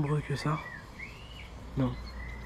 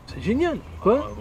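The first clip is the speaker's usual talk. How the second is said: high ironic exclamation; mock delight; sarcastic; 8 semitones higher, slower than usual. Ironic about empty account. (enthousiasme ironique)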